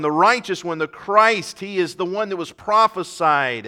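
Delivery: ordinary delivery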